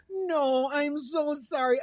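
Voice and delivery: very high, drawn-out Midwestern apology; orchard woman. Retold or imitated. Orchard woman turning down a caller, high and slow. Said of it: "And the woman's like".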